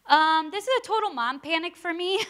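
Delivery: a laughing confession of mom panic; half-laugh, half-cry through it. Real, not acted. Pastor laughing about her own mom panic, laughing.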